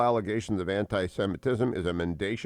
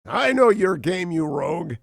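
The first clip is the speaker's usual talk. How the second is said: raised, forceful Scottish accent; 8 semitones higher, 6 dB louder than usual. Shown as a demonstration. Host quoting a film line to show off, Scottish accent. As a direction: put on a loud stage Scottish accent. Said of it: "That's what most of my Scottish accent is".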